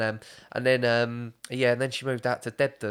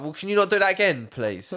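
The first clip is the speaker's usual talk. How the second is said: mock-polite officer, higher and quicker; mimicking the police; 7 semitones higher, 4 dB louder than usual. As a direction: plead politely like a bemused copper. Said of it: "they're like".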